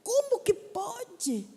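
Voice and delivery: slow, high incredulous question; acted amazement. Echoing people's reaction to her success, astonished. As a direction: stretch out the disbelief.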